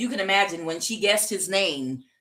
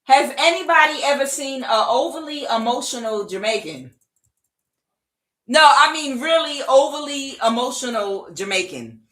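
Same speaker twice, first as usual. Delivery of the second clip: louder, higher, insistent question; emphatic; 6 semitones higher, 6 dB louder than usual. Real, not acted. Reader asking listeners about an emotional Jamaican, loud and emphatic. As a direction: press the question with comic force.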